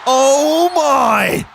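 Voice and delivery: high, drawn-out announcer impression; Michael Cole. Commentator amazed at ringside, high mock-announcer cry. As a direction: stretch it out like an astonished commentator. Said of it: "That's my Michael Cole impression".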